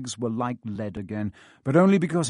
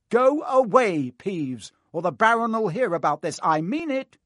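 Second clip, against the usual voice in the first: high, loud stern bark; 8 semitones higher, 5 dB louder than usual. Acted. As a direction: snap a warning at the prankster. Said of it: "barked Percy".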